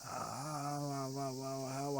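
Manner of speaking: soft, drawn-out pained moans; moaning in pain. Acted. Narrator lying hurt at the stairs, moaning softly. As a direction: groan weakly after the fall. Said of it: "I moaned".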